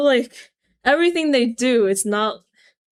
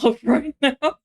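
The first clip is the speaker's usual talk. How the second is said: ordinary delivery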